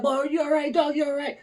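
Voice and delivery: high-pitched, softening imitation of worried helpers; concerned bystanders. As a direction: check on a man who just fell. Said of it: "People are trying to, like, lift me up".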